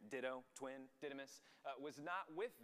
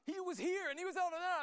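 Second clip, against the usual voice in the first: very high, loud excited yell; excited disciples; 11 dB louder than usual. Retold or imitated. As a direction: burst out with amazing news, imitating excited friends. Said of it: "they're just excited and confused".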